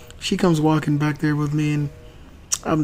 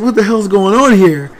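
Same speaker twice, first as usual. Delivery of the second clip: exasperated; 4 semitones higher, 6 dB louder than usual. Retold or imitated. Dad baffled, turning to wife.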